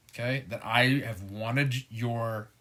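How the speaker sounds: normal talking voice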